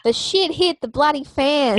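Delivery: bad Australian accent. Joking with her co-host about Australian politics, in a terrible mock Australian accent. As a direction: lay on an awful Australian accent for a laugh. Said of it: "That's my Australian accent".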